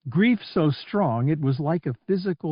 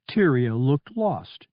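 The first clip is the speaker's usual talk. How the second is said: plain reading voice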